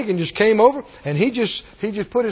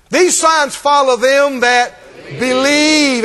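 preaching scripture; 3 semitones higher, 5 dB louder, slower than usual. Acted. Preaching scripture at full force.

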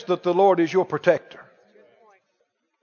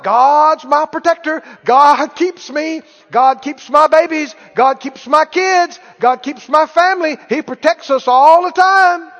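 higher, louder, rhythmic list; insistent declaring; 4 semitones higher, 4 dB louder than usual. Acted. Believer who goes around all the time confessing protection, insistent declaring. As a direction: pile up each declaration with rising force.

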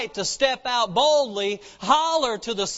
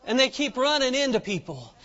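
ordinary talk, nothing marked

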